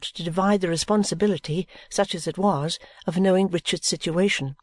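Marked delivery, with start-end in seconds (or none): none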